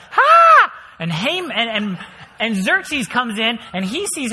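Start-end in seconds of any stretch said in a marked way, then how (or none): 0.1-0.7 sudden very high, loud shriek; startled shriek. Retold or imitated. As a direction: yelp as someone falls on you. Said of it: "And Esther's like".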